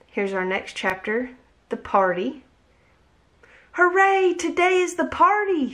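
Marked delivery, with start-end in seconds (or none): 3.7-5.7 excited shout. A narrator's reading. Charlie shouting to the family about the party. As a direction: cheer it out like a thrilled kid. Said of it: "shouted Charlie".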